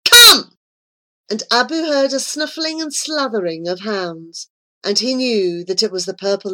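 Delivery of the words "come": very loud, high shout; screamed command. A narrator's reading. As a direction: scream the order sharply. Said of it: "the angel screamed".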